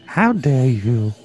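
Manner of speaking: low, slow outraged reproach; theatrical indignation. Acted. Fan rebuking co-host for insulting the star, low indignation. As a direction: draw it out low and offended.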